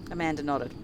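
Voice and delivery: ordinary talk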